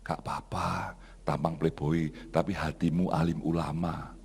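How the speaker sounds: low, soft reassurance; God speaking gently. Retold or imitated. God answering the hurt pastor, low gentle reassurance. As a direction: drop to a quiet, soothing voice. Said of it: "Tuhan hanya ngomong".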